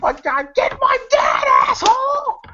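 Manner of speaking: high, screaming in frustration; furious shout. Retold or imitated. Nancy yelling at the cop to get her dad, furious. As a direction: yell at him out of sheer exasperation. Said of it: "And Nancy says".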